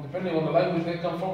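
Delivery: ordinary talk, nothing marked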